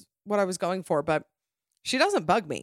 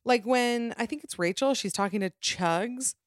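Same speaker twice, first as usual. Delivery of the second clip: ordinary delivery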